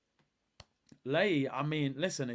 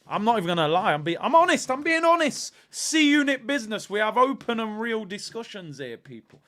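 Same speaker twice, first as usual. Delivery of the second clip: fired-up rant; 6 semitones higher, 7 dB louder than usual. Real, not acted. Worked-up fan rant.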